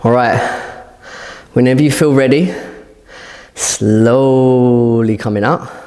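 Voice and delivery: breathless after the hold; out of breath. Real, not acted. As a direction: speak while catching your breath. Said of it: "I'm out of breath".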